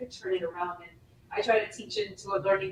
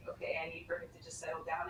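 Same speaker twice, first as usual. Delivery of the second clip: whispered inner thought; 4 semitones lower, 12 dB quieter, faster than usual. Retold or imitated.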